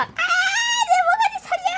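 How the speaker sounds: high shrill cry for help; panicked cry. Acted. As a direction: squeal it out in panic. Trapped, crying for help.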